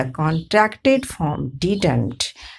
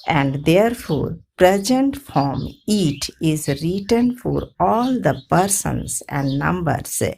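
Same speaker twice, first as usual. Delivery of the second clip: ordinary delivery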